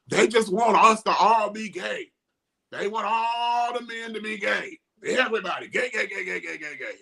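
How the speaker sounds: high, whiny mock complaint; mocking exasperated men. Mocking men's poll responses.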